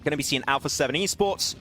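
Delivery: energetic esports caster calling the action; live play-by-play. Acted. Caster calling Svan's clutch for stream viewers, live play-by-play. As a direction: narrate the fight as it happens, rising at the clutch. Casting a battle royale match.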